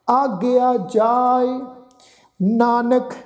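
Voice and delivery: slow, drawn-out sacred recitation; measured Gurbani reading, sustained line endings; scripture recitation. Shown as a demonstration. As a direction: chant each phrase slowly with long held vowels.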